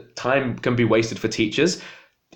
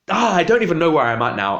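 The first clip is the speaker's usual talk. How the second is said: normal talking voice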